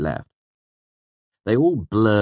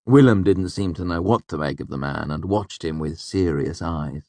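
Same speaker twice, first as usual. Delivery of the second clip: ordinary delivery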